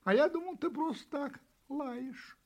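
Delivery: high-pitched, innocent kitten. A narrator's reading. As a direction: explain innocently in a high little voice. Kitten explains his mistake. (высокий голосок котёнка)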